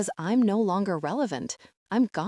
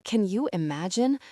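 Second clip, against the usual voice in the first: ordinary delivery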